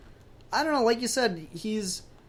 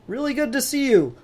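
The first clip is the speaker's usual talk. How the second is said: big, high, loud friendly hello, laughing at the end; hearty greeting; 9 semitones higher, 11 dB louder than usual. Acted. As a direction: greet an old friend with a burst of joy. Hal greets Green Lantern, acted.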